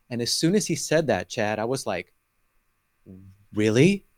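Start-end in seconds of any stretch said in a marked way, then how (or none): 3.5-4.1 louder, questioning rise; blurted surprise. Retold or imitated. Man retelling his reaction to a pastor, blurted surprise. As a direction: let the question burst out, retold. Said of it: "something pushed out of me".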